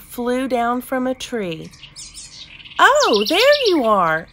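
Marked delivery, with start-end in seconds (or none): 2.8-4.3 high, loud bird squawk of delight; exclaiming. A narrator's reading. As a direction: burst out loudly in a high bird voice. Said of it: "it exclaimed".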